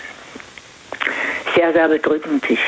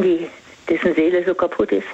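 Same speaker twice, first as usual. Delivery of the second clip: ordinary talk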